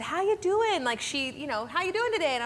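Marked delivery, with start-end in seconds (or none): 0.0-0.8 lightly voiced friend, pitched higher; a kind friend checking in, sung up high; cousin's caring greeting. Cousin greeting her after church, high and gentle. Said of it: "Matt's cousin at the time said". 1.7-2.3 lightly voiced friend, pitched higher; a kind friend checking in, sung up high; cousin's caring greeting. Cousin greeting her after church, high and gentle.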